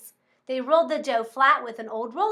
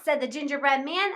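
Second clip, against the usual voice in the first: ordinary delivery, nothing marked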